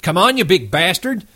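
high, strained rage; Curly exploding at Lenny, high and furious; raging challenge. Acted. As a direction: spit the challenge out high and wild. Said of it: "Curly's rage exploded".

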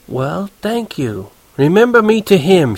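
high, gracious lady voice; drawn-out thanks, then lifting pleasantly; suddenly friendly. A narrator's reading. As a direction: lay on the social niceties. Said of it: "She was getting friendly as hell".

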